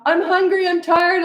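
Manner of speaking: high-pitched imitation of a toddler's whine; whining toddler. Toddler trying to pull parent in, whiny mock tantrum. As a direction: complain like a tantruming little kid.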